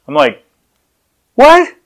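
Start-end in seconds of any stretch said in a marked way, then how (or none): 1.4-1.7 very high, squeaky disbelief; incredulous surprise. Retold or imitated. Husband who forgot dinner plans, incredulous. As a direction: blurt it in mock shock, voice shooting up.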